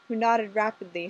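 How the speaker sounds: ordinary delivery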